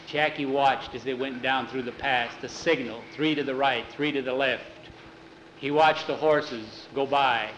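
ordinary delivery, nothing marked

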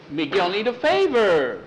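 high, emphatic plea swooping down; insistent character plea with a long falling end; pleading insistence. Acted. Miguel pleads, contrasted with Jackie's reply.

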